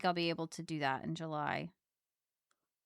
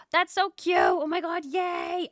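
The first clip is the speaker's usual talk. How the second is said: excited gushing; 10 semitones higher, 6 dB louder, slower than usual. Real, not acted. Gushing over friend news.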